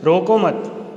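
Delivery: रोको मत run together with no break, falling at the end; रोको मत as one group. Shown as a demonstration. Teacher contrasting the second sentence for pupils, no pause before मत. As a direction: join "मत" straight onto "रोको". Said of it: "लेकिन दूसरे में".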